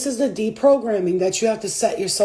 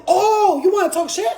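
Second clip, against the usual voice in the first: high, loud, affronted challenge; acted indignation; 8 semitones higher, 3 dB louder than usual. Woman challenging her spirit guides, mock indignant. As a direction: re-enact squaring up for an argument. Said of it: "I'll be cursing them out".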